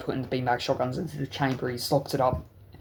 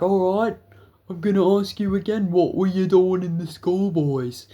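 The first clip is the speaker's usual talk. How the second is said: higher, louder mock interrogation; loud stern cop; 6 semitones higher, 5 dB louder than usual. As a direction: press them like an impatient officer. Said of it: "very loud".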